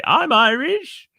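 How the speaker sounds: high, slow mock claim. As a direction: pitch it high and slow as a fake boast. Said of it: "I don't say".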